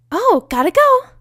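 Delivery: high, loud and bouncy; bubbly Pinky voice. Acted. Pinky darting off toward Sea Swirl, high and giddy. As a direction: squeal it out like an excited pony.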